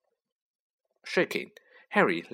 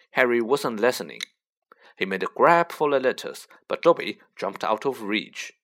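usual speaking voice

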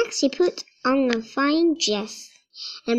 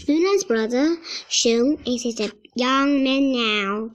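ordinary delivery

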